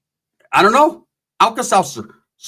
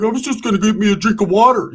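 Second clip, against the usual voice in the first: meek excuse; 4 semitones higher than usual. Retold or imitated. Booger caught standing up.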